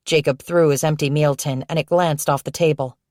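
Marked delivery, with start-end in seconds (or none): none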